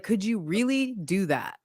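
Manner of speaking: ordinary talk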